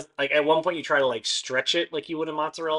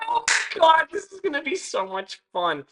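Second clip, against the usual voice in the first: high squealing delight; overjoyed and giddy; gushing excitement; 10 dB louder than usual. Acted.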